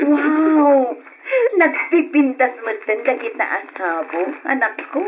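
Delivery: high, loud delighted wow. Acted. Mother seeing her children's gift, loud delighted wow. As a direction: gasp it out, thrilled by the gift.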